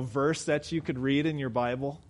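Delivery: ordinary talk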